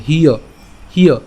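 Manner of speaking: "হিয়" as a short hi gliding into ya; short-i pronunciation. Shown as a demonstration. Teacher explaining the joined h and y, careful short "হিয়". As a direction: say "হিয়" crisply with short i plus ya. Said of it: "রসিকার আকারে আমরা উচ্চারণ করতে পারি".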